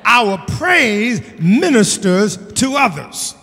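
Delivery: loud, stretched-out key words; rousing sermon delivery with punched words; emphatic preaching. Real, not acted. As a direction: preach it out to the congregation. Preaching at full force.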